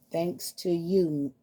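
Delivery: slow, pointed "thanks to you"; teasing the other parrot, slow and pointed; drawn-out blame. Acted. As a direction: stretch it out to pin the blame on Rico. Blaming the other bird.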